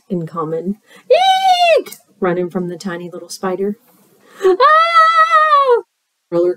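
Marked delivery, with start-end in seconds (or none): 1.1-1.9 very high squealed shriek; frightened eek. Acted. Kids running from the tiny spider, piercing squeal. 4.4-5.8 long, very high "ah"; roller coaster scream. Acted. Kids riding roller coasters, long high scream.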